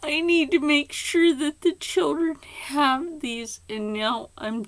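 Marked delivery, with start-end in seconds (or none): 0.0-3.6 high, loud, urgent self-quote; remembering late-night resolve, high and loud; mock urgent determination. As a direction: play her past self, high and pressing. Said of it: "I was like".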